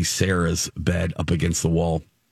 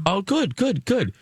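pitched way up, nervous and polite; awkward high reply; 12 semitones higher than usual. Retold or imitated. To the creepy roommate, an awkward high-pitched reply. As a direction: cover the unease with a bright tone.

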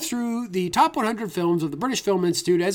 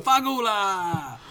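mock Italian echo; much slower than usual. Echoing the Italian curse.

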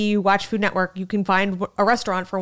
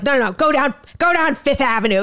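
loud, raised tour-guide rant; Tim Dillon; 6 semitones higher, 4 dB louder than usual. Retold or imitated. Comic imitating a tour guide to the host, raspy and loud. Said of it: "in his f-, fucking horrifying, raspy homo voice".

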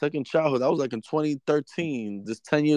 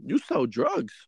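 exasperated retort; 9 semitones higher than usual. Retold or imitated. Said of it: "I was like".

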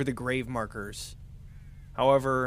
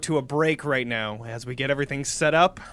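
loud, hyped show host; announcer voice; 3 semitones higher, 8 dB louder than usual. Acted. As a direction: pump up the audience for the break. Announcing break to audience.

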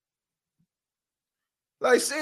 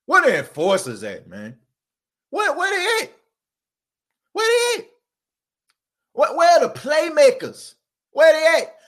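fired up; 5 semitones higher than usual. Real, not acted. Fired-up rant calling out players.

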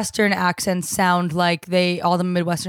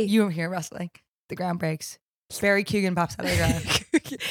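low, softened storyteller narration; hushed scene-setting; 4 semitones lower, 6 dB quieter than usual. Acted. Friend narrating the groundhog's emergence, low and suspenseful. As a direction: paint the scene with measured suspense.

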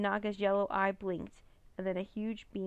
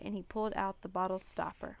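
plain reading voice

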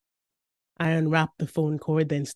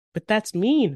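put-on high, louder kid protest; raised-pitch childlike objection; protesting little brother; 6 semitones higher, 6 dB louder than usual. A narrator's reading.

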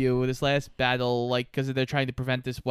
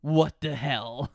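slow, lazy tough-guy drawl; Jack Byrne impression; 2 semitones lower, slower than usual. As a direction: play the cocky hero, slowly. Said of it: "You know what Jack Byrne always says?".

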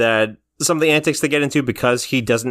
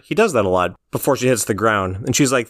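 usual speaking voice

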